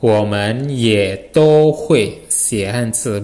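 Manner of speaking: slow, even "Women ye to hui xie hanzi"; slow Mandarin model. Shown as a demonstration. Teacher repeats the sentence slowly for the class. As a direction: read it out carefully for learners. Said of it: "Kalimatnya jadi gimana?".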